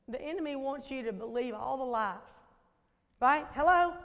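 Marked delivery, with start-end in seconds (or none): none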